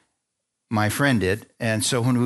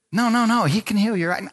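higher-pitched, insistent coaxing; retold urging; 8 semitones higher than usual. Friend urging man toward healing, insistent. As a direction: imitate a friend who won't take no.

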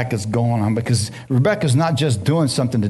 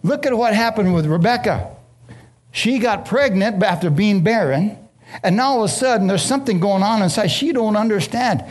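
raised, driving preacher's cadence; preaching at full force; 8 semitones higher than usual. Acted. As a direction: preach it higher and harder, driving the point home.

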